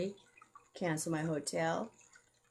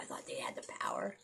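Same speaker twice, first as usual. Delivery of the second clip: fast hushed whisper, much softer; conspiratorial whisper; 6 semitones lower, 10 dB quieter, faster than usual. Real, not acted. Telling the viewers about a secret group, hushed whisper.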